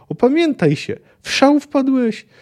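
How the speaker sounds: high-pitched urgent shouting; forceful, high reproachful shout; acted roar. A narrator's reading. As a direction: yell to shake a friend out of his frenzy. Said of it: "ryknął Kahir".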